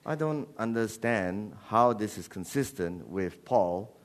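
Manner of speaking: ordinary talk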